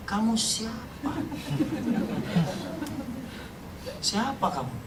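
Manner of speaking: slow, soft voice of God; soft divine question. Retold or imitated. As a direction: ask it gently, searching. God's reply, quoted. (suara Tuhan yang lembut dan pelan)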